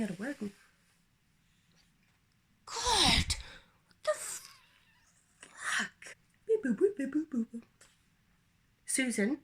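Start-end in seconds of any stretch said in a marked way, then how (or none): 2.7-6.2 high, drawn-out shocked wail; shocked cry. Retold or imitated. Discovers booger in car mirror. 6.5-7.6 high beeping noises; beep boop sounds. Retold or imitated. Imitating sound effect.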